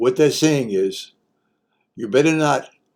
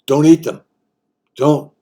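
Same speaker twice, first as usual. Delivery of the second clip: urgent warning; 5 semitones higher, 9 dB louder than usual. Acted.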